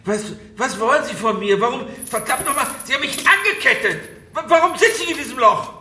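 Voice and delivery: rising panic. Acted. Chained man demanding answers from his captor, panicking.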